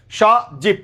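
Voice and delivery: স জীব as "sho... jib"; split syllables. Shown as a demonstration. Teacher giving students the answer, স জীব in two syllables. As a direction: hold স, then close জীব on the b. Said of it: "তাহলে কি হবে".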